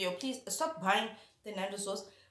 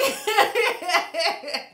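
high laugh; 9 semitones higher, 9 dB louder than usual. Real, not acted. Laughs after confession.

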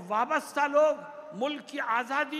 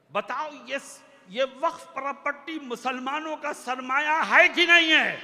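rousing challenge; 5 dB louder than usual. Real, not acted. Orator asks Muslims whose waqf property it is, rousing challenge. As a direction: throw the question hard at the crowd. Demands an answer from the crowd.

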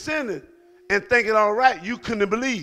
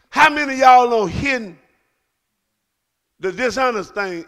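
louder, higher, shouting preacher; preaching at full force; 4 semitones higher, 6 dB louder than usual. Acted. Pastor calling out hidden sins, shouted preaching. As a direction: rouse the congregation, shouting.

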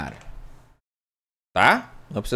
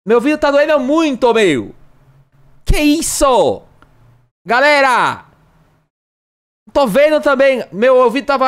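higher and louder mock youtuber shouting; hammed-up youtuber yelling; 4 semitones higher, 5 dB louder than usual. Streamer still playing a loud youtuber, mock yelling to chat. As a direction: keep yelling like the hype host, complaining about the noise. Keeps loud parody, ears hurting.

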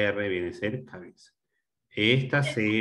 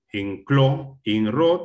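"clo" and "ro" said slowly and louder, each set apart; syllable by syllable; 4 semitones higher, 7 dB louder, much slower than usual. Shown as a demonstration. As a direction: break the word into loud, separate beats, "clo", "ro". Teacher splits cloro into syllables. (sílaba por sílaba, "clo" y "ro" lentas y marcadas)

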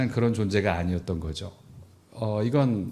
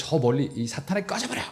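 mock banishment; 4 semitones higher than usual. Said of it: "뭐 이런 뜻이 아니라".

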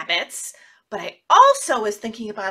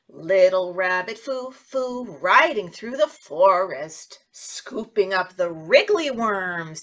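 put-on slow, sing-song story voice for children; storytelling; 4 semitones lower, slower than usual. Teacher telling a rhyme to small children. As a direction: tell it slowly like a kids' story.

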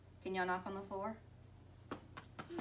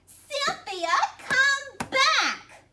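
put-on very high, loud child's shout; shrieked little-kid order; demanding child; 12 dB louder, much slower than usual. A narrator's reading. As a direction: demand it at the top of your voice. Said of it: "Emma demanded".

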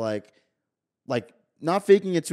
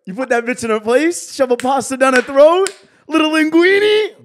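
mock aggressive; 14 semitones higher, 11 dB louder than usual. Mocking S0 snapping at girl.

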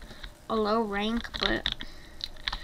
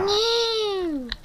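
high, long drawn-out no; gleefully hammed-up high "no"; mock defiant cry; 10 semitones higher, 4 dB louder than usual. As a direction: wail a playful refusal. Mock defiance of angry players.

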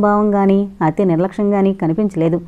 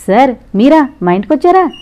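happy surprise; 5 semitones higher, 6 dB louder than usual. A narrator's reading. Son delighted to see principal.

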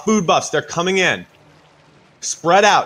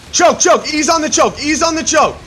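shouted callout; 11 semitones higher, 6 dB louder than usual. Real, not acted. Raid leader shouting at choke.

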